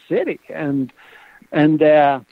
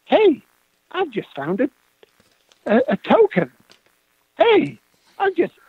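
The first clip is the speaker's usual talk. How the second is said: much higher, eager calling out over a find; excited call; 9 semitones higher than usual. Retold or imitated. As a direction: shout the find up high, thrilled. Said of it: "and he says".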